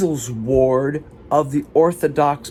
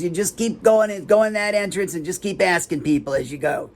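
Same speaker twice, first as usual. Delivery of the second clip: higher, put-on receptionist voice; dismissive receptionist; 5 semitones higher than usual. Receptionist gives vague directions to a visitor, higher imitated voice. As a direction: rattle off directions in a raised voice. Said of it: "She goes".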